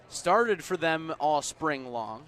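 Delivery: normal talking voice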